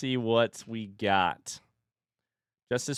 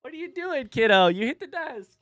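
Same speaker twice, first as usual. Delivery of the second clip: playful high-pitched parent voice; 12 semitones higher than usual. Real, not acted. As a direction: coo at a little one way up high. Talking to own toddler.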